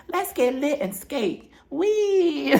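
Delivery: high, drawn-out mock cheer; giddy party squeal. Recapping a friend at the skating party, giddy high imitation.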